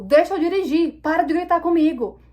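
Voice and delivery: indignant acted telling-off; re-enacted angry retort. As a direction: show exactly how you snapped back. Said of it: "eu falei desse jeito com ela".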